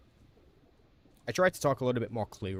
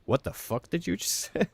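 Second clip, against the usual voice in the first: high, baffled voice of a listener who can't follow, breaking into a laugh; confused listener; 9 semitones higher than usual. Retold or imitated. Streamer imitating people who can't follow his fast accent, high and baffled. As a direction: play someone who didn't catch a word of it. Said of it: "they're like".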